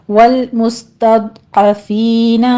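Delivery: very slow chanted tajweed reading; measured Quran recitation, vowels held two counts; slow Quranic recitation. Shown as a demonstration. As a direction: recite it slowly as a model for students. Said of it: "لام کو بھی stop کریں گے".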